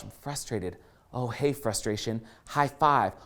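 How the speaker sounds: low, soft aside to yourself; hushed realization. Retold or imitated. Speaker privately greeting own frustration, low and hushed. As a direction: drop to a quiet, low greeting.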